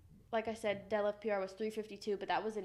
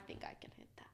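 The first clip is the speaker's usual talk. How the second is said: whispering; 15 dB quieter, faster than usual. Real, not acted. Quiet aside about her goal.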